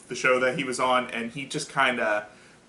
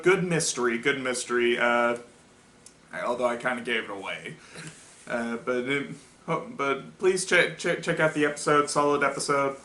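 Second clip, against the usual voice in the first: usual speaking voice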